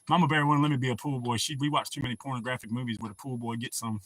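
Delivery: ordinary talk, nothing marked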